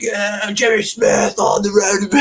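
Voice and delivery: high, slow shouted fan call; mocking fan shout. Fan spots him on the road, high mock shout. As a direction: belt it like a fan across the crowd. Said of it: "somebody was like".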